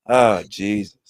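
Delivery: ordinary talk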